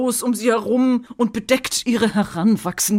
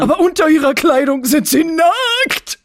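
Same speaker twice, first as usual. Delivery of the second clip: put-on excited kid voice; 8 semitones higher, 5 dB louder than usual.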